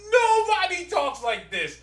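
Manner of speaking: high-pitched exasperated disbelief; incredulous protest. Real, not acted. Complaining about awful comic dialogue, incredulous protest.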